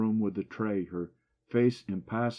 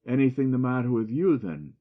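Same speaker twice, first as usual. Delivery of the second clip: higher and louder, a sarcastic jab; 5 semitones higher, 7 dB louder than usual. A narrator's reading. The doctor sarcastically turning the jibe back on Joe. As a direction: throw the question back with sarcasm. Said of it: "he asked sarcastically".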